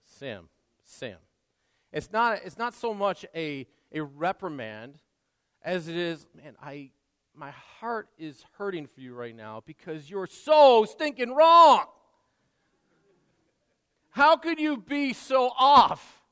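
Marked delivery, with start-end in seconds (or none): 0.0-1.2 gentle. Acted. Calling his son's name. 6.3-16.2 builds to a loud, slow, hurt cry; exasperated. Acted. Jesus voiced as pained rebuke, loud and slow.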